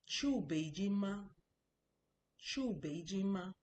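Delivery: slow Chu Beijing ma, every tone drawn out; Mandarin tones. Shown as a demonstration. Teacher drilling a Mandarin question for the class, slow and clear. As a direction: sound out Chu Beijing ma slowly, tone by tone. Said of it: "If you watch my tones".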